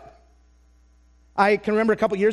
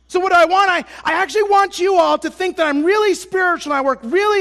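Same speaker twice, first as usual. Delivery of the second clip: loud, high preaching at full force; 5 semitones higher, 4 dB louder than usual. Acted. Preacher confessing his selfish wants to the congregation at full force. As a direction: drive the confession home to the congregation.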